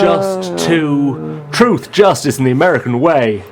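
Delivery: put-on overdone American accent; hammy American accent; bad American accent. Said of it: "That is a very bad American accent".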